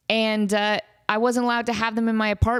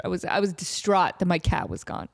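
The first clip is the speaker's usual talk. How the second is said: usual speaking voice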